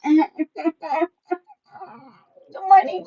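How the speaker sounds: usual speaking voice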